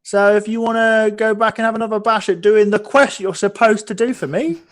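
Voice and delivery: high, loud innkeeper voice. Acted. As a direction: play the gruff innkeeper. Innkeeper NPC voice.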